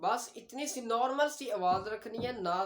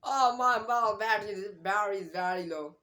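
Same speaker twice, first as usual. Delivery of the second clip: louder, slow British accent with "battery" said with a d sound; 6 dB louder, much slower than usual. Shown as a demonstration. Teacher shows a British speaker talking about a phone battery, British accent. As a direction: soften the t in "battery" to a d, British style. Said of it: "अगर British इसमें बात करे तो वो ये कहेगा".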